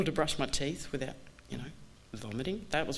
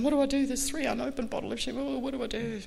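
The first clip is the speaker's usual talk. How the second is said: mock panic; 9 semitones higher than usual. Ringing her sponsor about the champagne, mock panic. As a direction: squeal it like a frantic caller. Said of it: "ringing my sponsor in a panic".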